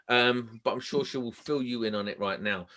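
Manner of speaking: ordinary talk, nothing marked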